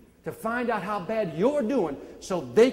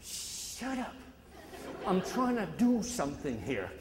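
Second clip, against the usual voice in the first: soft, breathy dismissal; hushed shut up, barely voiced; quiet shut-up; 8 dB quieter, slower than usual. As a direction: mutter it under your breath. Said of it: "I told him".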